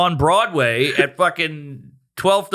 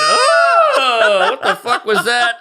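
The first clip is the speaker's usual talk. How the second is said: high, loud outraged gasp into words; imitating an appalled audience, high and loud; shocked audience; 8 semitones higher, 5 dB louder, slower than usual. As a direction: react like a stunned crowd. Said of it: "the audience went".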